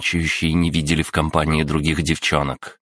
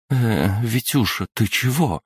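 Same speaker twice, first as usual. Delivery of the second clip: higher, wary mother questioning her son; a puzzled, suspicious mum, pitched higher; mother eyeing her son suspiciously, higher voice; 7 semitones higher, slower than usual. Acted. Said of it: "с подозрением покосилась".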